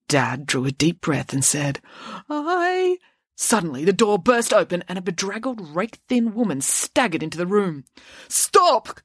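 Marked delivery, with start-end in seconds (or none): 2.3-3.0 nervous. A narrator's reading. Dad's hesitant high voice. 8.3-9.0 high urgent cry. A narrator's reading. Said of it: "cried the woman".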